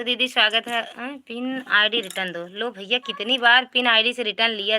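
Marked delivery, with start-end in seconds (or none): none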